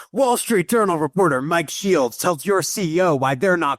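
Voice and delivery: high, pumped-up YouTube hype read; hype headline voice. Pretend. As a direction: sell it like clickbait hype. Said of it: "YouTube hype beast headline".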